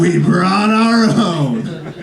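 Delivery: slow, drawn-out proud mom; slow laughing reveal. Retold or imitated. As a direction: lay it out like a triumph. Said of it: "she goes".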